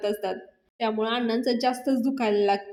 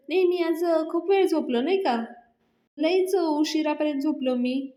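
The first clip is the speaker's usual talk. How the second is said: put-on drowsy old man; 5 semitones higher than usual. Groggy Anna, acted quote.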